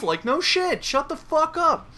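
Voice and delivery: very high-pitched annoyed comeback; exasperated retort. Acted. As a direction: shoot down the heckler in a squeal. Snapping back at movie heckler.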